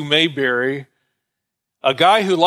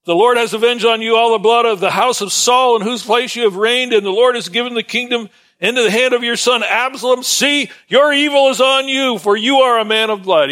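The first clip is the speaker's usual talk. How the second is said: higher, fast South Carolina rant, a bit less wild; South Carolina accent; 6 semitones higher, faster than usual. Acted. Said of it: "it said in a good South Carolina accent".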